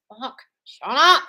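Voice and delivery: slow, high, loud mock outburst; acted exasperated retort. Coach retelling her answer to housing doubters, mock exasperated. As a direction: act out brushing off the naysayers loudly.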